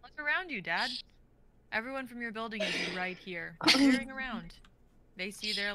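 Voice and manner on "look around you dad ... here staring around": exasperated. Acted. Dog exasperated with owner.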